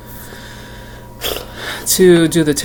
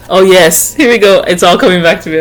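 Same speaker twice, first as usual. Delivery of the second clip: excited; 4 semitones higher, 9 dB louder than usual. Real, not acted. Memory of singing returns.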